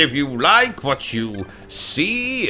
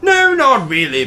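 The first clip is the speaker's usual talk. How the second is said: sharp, higher, louder no; mocking denial to a gullible helper; sarcastic; 9 semitones higher, 5 dB louder than usual. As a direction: snap back a sarcastic no. Sarcastic retort to Bertram.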